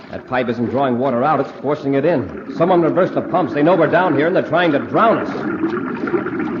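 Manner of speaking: rising alarm. Acted. Commander realizing the pumps will drown them, alarmed.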